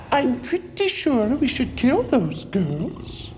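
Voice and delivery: high, limp, unsure voice; hesitant demo. Shown as a demonstration. Zafaros showing heroes how doubt sounds, high wishy-washy mimicry. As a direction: mimic someone who lacks conviction. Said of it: "allow me to demonstrate".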